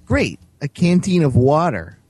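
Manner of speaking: loud, slowed mock enthusiasm; sarcastic. A narrator's reading. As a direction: drip sarcasm over the gift. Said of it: "said Tim sarcastically".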